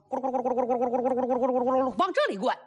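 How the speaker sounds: water pouring in, fast patter; rapid gurgling. A narrator's reading. Storyteller rattles off gurgling water, very fast.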